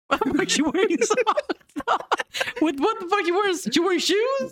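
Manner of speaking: high, laughing incredulous questions; squeaky giggling disbelief. Real, not acted. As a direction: crack up while asking.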